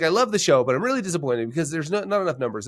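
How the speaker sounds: high, mock-upset complaint; a hint of a grumpy comedian whining; upset guest. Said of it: "He's like".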